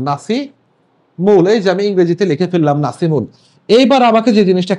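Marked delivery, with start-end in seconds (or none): 0.0-1.5 spelled-out slowly. Shown as a demonstration.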